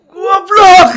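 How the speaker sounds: explosive, high-pitched furious scream; yelling again. Retold or imitated. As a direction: scream the insult at full force. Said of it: "teriak lagi". (teriakan marah yang meledak)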